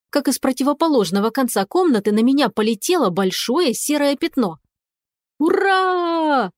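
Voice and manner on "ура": high, bright squeal of a happy cat; joyful cat squeal. A narrator's reading. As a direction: squeal it with delight. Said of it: "радостный кошачий визг". (радостный кошачий визг)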